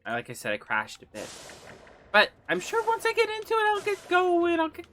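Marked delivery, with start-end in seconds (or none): 2.1-4.9 much higher, mock-energetic streamer voice; hyped-up squeaky voice. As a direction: squeak it out like a hyper version of yourself, fading at the end. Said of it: "I'll be loud, I'll be fast, I'll be funny".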